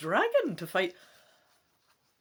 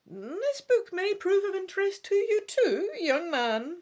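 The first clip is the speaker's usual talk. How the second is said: put-on much higher, louder voice for the old woman; old shopkeeper woman; 13 semitones higher, 5 dB louder than usual. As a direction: play the old woman selling books. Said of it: "Madame Ursula adds".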